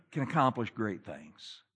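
ordinary talk

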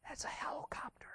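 put-on very soft, secretive child's whisper; little kid's whisper. Little kid tells boss who is there, whispering. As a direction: whisper like a tiny kid on the phone. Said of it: "this little kid's voice".

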